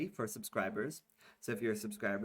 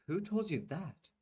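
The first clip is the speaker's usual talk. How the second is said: put-on higher, doubtful young woman; Tamika, unconvinced, pitched up; 8 semitones higher than usual. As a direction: question him, unconvinced.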